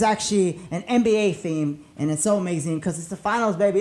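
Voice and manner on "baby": ordinary talk, nothing marked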